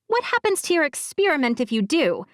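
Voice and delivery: put-on girl demanding an explanation; high, indignant girl's voice. A narrator's reading. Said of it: "the girl asked".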